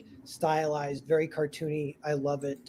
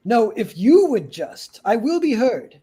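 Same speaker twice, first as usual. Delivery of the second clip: slow, louder and higher, insisting on being heard; indignant guest; 6 semitones higher, 4 dB louder, slower than usual. Acted. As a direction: talk over her, outraged. Guest shouts down the receptionist.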